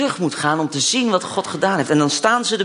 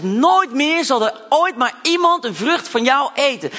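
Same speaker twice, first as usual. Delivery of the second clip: Jesus cursing the tree; 7 semitones higher than usual. Retold or imitated. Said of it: "Hij zegt".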